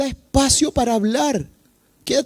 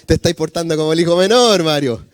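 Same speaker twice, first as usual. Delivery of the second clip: mock scolding; 8 semitones lower, faster than usual. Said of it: "le decimos".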